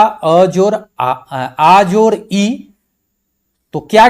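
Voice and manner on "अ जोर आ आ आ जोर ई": drawn-out letter breakdown; slow spelling out. Shown as a demonstration. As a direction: stretch म जोर ए out slowly for students. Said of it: "यहां पर मिला था". (धीरे, खींचकर वर्ण बोलना)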